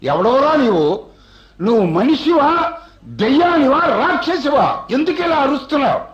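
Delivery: high angry cry of defiance; retold anger. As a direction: demand it name itself, pitch climbing. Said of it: "నాకు అలా కోపం వచ్చన్నాను".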